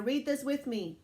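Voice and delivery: ordinary delivery, nothing marked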